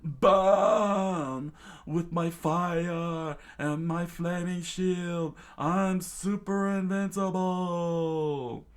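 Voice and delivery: high, slow, loud boastful battle cry; triumphant drawn-out high yell; Pearl screaming. A narrator's reading. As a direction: bellow it high, stretching every phrase. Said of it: "Pearl screamed out once more".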